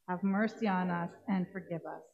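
usual speaking voice

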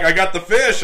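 high, loud panicky cry; freaking out. Retold or imitated. Hooked a fish and panicking to his partner, loud. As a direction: shout it in a panic. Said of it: "You're freaking out".

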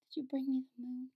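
fast, breathy little whisper. Retold or imitated. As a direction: whisper it like a sick child. Retold weak princess.

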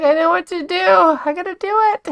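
high, eager determined chatter. Pretend. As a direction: voice the sim as eager. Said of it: "She's like".

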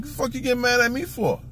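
retold indignant protest, pitched high; indignant retort. Man answering the woman who got mad at him, indignant.

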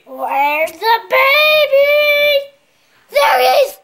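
slow singsong, then loud cry; coo, then burst out happily; peekaboo singsong. Pretend. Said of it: "a little stronger".

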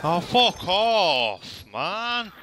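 high, slow exasperated cry; drawn-out disbelieving groan at the game; frustrated outburst. Real, not acted. Frustrated at being killed.

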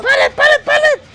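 high, loud excited yell; excited shouting. Real, not acted. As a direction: shout it out in triumph. Calling out the pallet stun. (grito emocionado)

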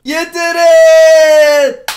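very high, drawn-out cheer; triumphant shout. Real, not acted. Gamer cheering a shy caller for saying the word, triumphant shout. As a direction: yell it high and loud, stretching the end.

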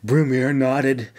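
plain reading voice

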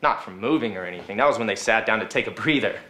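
normal talking voice

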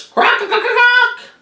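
very high croaking squawks; parrot squawk. Acted. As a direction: crow loudly at the morning. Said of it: "greeted the morning with a loud ruckus".

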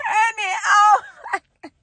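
high, loud gushing squeal; squealing excitement. Real, not acted. Fan gushing about her crush Michael, squealing with excitement.

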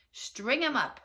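high, loud call for punishment; angry mob shout. A narrator's reading. Townsmen turning on Big Anthony, shouting. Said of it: "the men of the town shouted".

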